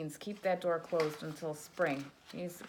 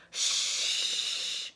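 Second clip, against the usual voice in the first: shush; 7 dB louder than usual. Acted. Animals hush the snoring bear.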